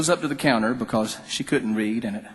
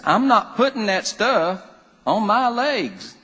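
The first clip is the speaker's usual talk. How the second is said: much higher, very slow Southern drawl, put out; high, drawn-out country huff from a perturbed mama; indignant country mama; 10 semitones higher, 3 dB louder, slower than usual. Retold or imitated. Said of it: "she talked real slow and she was real country".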